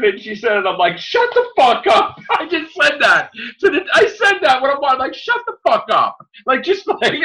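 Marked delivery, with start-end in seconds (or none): 1.0-2.2 much higher, louder, slow and disbelieving; mock furious outburst. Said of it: "I was fucking angry". 5.1-6.2 higher, a touch of outrage; milder angry retelling. Retold or imitated. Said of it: "I was fucking angry".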